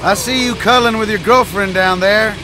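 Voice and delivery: calling down. Acted. Stranger calls down to the kid, loud and mocking. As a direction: call down to him with a jeer.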